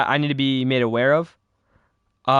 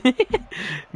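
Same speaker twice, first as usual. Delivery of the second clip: high laugh; 10 semitones higher than usual. Real, not acted. Laugh after auctioneer bit.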